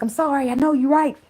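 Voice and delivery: high pleading, slow on the last lines; begging him back. Retold or imitated. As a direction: whine and beg up high. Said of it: "I got on that leg begging".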